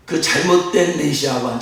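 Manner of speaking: ordinary talk, nothing marked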